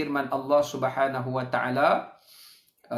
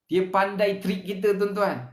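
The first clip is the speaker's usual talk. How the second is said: usual speaking voice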